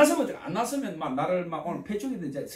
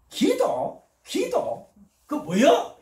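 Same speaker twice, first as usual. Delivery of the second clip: much higher, scoffing repeated "prayer?"; incredulous drunk; 9 semitones higher, 4 dB louder, much slower than usual. Retold or imitated. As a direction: toss the word back in disbelief. Drunk man mocks prayer offer.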